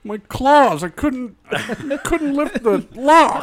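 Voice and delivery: high, slow, laughing bear voice; whiny bear voice. Pretend. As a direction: whine like a clumsy bear. Bear complaining about claws.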